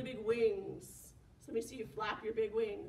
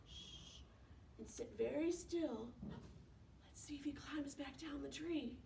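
soft unvoiced whisper; 11 dB quieter than usual. Shown as a demonstration. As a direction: hush the room. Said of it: "be very quiet".

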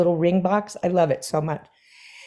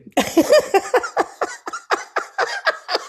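laugh. Real, not acted. Laughs at Sondheim's punchline.

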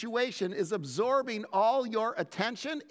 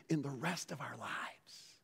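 hushed, whispered close; 8 semitones lower, 11 dB quieter than usual. Real, not acted. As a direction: lean in and breathe it. Sermon point dropping to hush.

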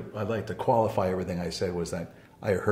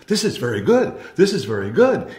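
higher, much louder delighted exclamation; bright, delighted; 6 semitones higher, 9 dB louder than usual. Retold or imitated. Guru to a grieving woman, brightly delighted. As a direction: voice a guru lighting up with emphatic approval. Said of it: "he gets all bright".

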